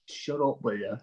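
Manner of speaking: soft, tired grumble; weary muttered complaint. Retold or imitated. Trying to nap while the lads bang about, weary mutter. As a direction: grumble it under your breath, half asleep. Tired bandmate voicing his annoyed plea.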